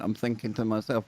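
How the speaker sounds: usual speaking voice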